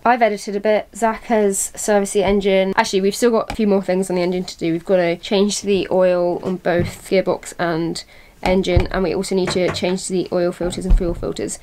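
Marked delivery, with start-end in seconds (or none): none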